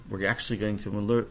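ordinary delivery, nothing marked

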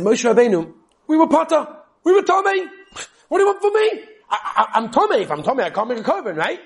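high, loud pleading complaint; mock complaint. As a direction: play the people protesting they are exempt. People complaining to Moshe.

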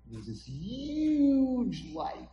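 HUGE stretched out long and high; awed emphasis. A narrator's reading. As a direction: draw out "huge" in a rising swoop. Narrator marvels at the light.

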